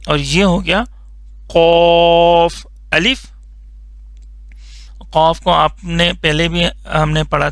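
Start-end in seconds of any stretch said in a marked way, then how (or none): 1.5-3.3 قاف said thick and heavy from the throat, slow; heavy qaaf. Shown as a demonstration. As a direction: give قاف a full heavy sound, drawn out. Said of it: "موٹا کر کے پڑھنا ہے".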